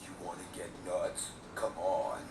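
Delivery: low, menacing quote; low gravelly Batman. Acted. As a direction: say it low and dangerous.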